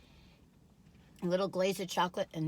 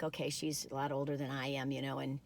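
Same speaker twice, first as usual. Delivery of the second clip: inner thought voice; 7 semitones lower, 6 dB quieter than usual. Retold or imitated. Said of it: "I'm like".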